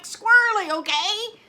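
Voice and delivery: loud, high mock-exasperated outburst; exasperated retort. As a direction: belt it out as a retold last word. Exasperated retort in retold exchange.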